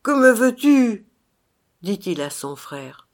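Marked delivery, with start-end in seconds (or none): 0.0-1.1 loud, high cry of struggle; desperate demand. A narrator's reading. Phantom confronts his brother, loud and desperate. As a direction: demand it loudly, in despair. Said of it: "poussa un soupir plein de lutte et de désespoir".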